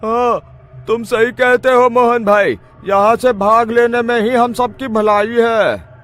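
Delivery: high, loud panicked voice; frightened shout. Acted. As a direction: agree in a terrified rush. Terrified villager agrees to flee.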